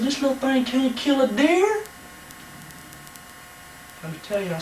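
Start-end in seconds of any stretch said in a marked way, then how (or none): 0.0-1.8 mock scoffing quote, higher. Host mocking his younger self, scoffing. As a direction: scoff at the little gun in a put-on voice. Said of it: "I said".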